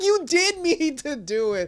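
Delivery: high, drawn-out contradiction; slow it down and pitch it up in protest; exasperated retort. Acted. Viewer argues back at apologizing villains, exasperated retort.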